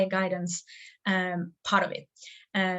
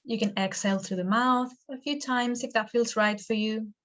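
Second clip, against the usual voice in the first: guided meditation. Acted. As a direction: lead them gently into stillness, stretching the words.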